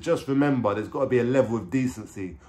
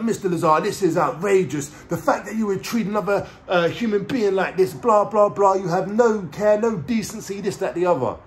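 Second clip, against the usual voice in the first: raised, high-pitched indignant judge impression; animated judge; 7 semitones higher than usual. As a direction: imitate a worked-up judge reprimanding a defendant. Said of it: "now I can see he's animated".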